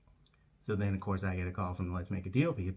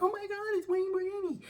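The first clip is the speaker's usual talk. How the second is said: high squealing fan gasp; excited fan squeal. Retold or imitated. Bank staff spotting a celebrity, starstruck squeal.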